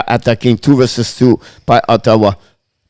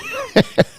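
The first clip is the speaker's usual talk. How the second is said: giggle; 6 dB quieter than usual. Real, not acted. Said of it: "I'm laughing because".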